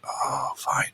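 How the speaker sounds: breathy, unvoiced resigned giving in; grudging whisper. Deputy talks him into the ice castle, he gives in with a whisper. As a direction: sigh out a reluctant yes under your breath.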